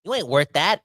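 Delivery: ordinary talk, nothing marked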